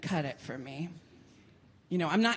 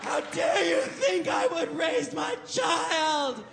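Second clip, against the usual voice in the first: mock furious tirade; 11 semitones higher, slower than usual. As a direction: rage at the guests like a zealot. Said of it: "taking fistfuls of the cake and going".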